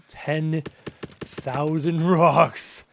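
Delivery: slow, weighty dwelling on the amount; exaggerated slow-motion delivery; drawn-out emphasis. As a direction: stretch each word very slowly. Repeats the number, dwelling on it.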